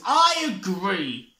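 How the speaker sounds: slow, loud cut-in; interrupting. Acted. Badger loudly cuts Moose off. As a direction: barge in over Moose. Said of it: "Badger interrupted".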